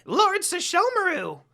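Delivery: very high, loud squawky call; little demon calling. A narrator's reading. Jokin flying in, calling his lord loudly. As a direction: shriek his lord's name from the air. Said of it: "Jokin got off".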